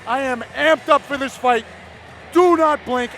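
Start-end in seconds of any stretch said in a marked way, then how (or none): 2.3-3.1 excited shout. Real, not acted. Commentator hyping fight start.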